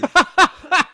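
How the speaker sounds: high, loud hearty laugh; roaring laugh at his own anger; loud burst of laughter. Real, not acted. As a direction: let out a big real laugh. Big laugh about being mad.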